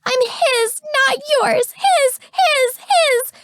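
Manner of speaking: high desperate shrieks; frantic screaming. A narrator's reading. Continuing frantic screaming fight.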